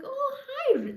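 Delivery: high surprised hi; mock startled greeting. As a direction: pop the hi up high. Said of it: "And he's like".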